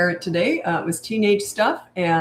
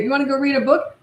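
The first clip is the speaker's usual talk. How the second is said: higher, cheery questioning lilt; 7 semitones higher, faster than usual. Shown as a demonstration. Parent still inviting crying child to read, cheery. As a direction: keep the offer cheerful and light. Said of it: "Just still have that cheery tone".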